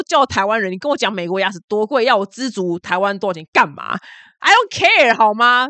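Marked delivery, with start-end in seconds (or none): 4.4-5.7 high, loud, sassy brush-off; defiant dismissal. Real, not acted. Vlogger brushing off a commenter, loud and sassy. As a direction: toss it off with attitude.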